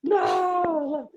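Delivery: very high, long drawn-out mock cry; retold outcry. Magician grabs the performer after the reveal, high drawn-out mock outcry. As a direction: imitate a magician's agonized high-pitched wail. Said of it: "he just grabbed me and he goes".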